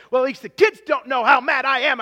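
loud, tense inner grumble; retold inward anger. Driver fuming after a speeding ticket, retold inward anger. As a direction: act out bottled-up anger. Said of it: "I'm just mad inside".

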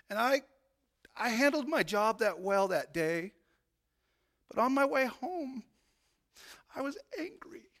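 loud, rising testimony, pushing hard; preaching at full force. Acted.

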